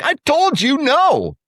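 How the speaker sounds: very high, slow scolding; father chasing son down the street, exasperated; scolding dad. Retold or imitated. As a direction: drag out each word in disbelief.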